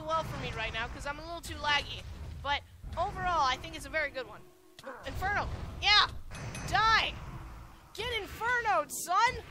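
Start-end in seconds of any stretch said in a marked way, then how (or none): 5.1-9.4 loud, higher excited taunt; fired-up gamer trash talk; battle cry. Real, not acted. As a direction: shout it at the enemy as you attack. Attacking enemies in game.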